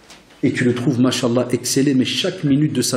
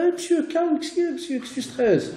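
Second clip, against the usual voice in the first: cheeky pupil. Retold or imitated. Teacher imitating a pupil telling him to calm down, high and cheeky. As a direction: whine it like a cheeky pupil shrugging off lateness. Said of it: "J'ai des élèves comme ça".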